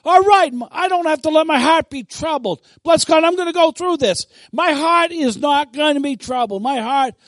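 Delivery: loud, high-pitched preaching declaration; serious, commanding faith. Acted. Believer takes God's word as a command, boldly. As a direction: declare it boldly as a standing order. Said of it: "as serious and as important".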